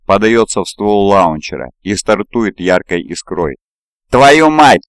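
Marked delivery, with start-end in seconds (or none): 4.1-4.8 furious yell of pain. A narrator's reading. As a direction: bellow it over the radio. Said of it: "орёт кто-то в эфире искажённым то ли от боли".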